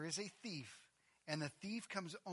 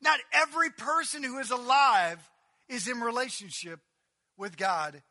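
loud, high preaching proclamation; full-force preaching; 7 semitones higher, 15 dB louder than usual. Acted. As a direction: thunder it out to the congregation.

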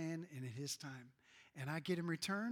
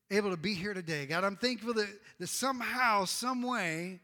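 loud, impassioned prayer rising over the music; fervent prayer; 3 semitones higher, 8 dB louder than usual. Real, not acted. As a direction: pour out thanks to God at full voice. Pastor leads congregation in prayer.

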